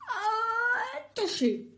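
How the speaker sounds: big high sneeze bursting out; loud cartoon sneeze; roaring sneeze. A narrator's reading. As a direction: let a huge sneeze explode. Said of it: "roars Portly".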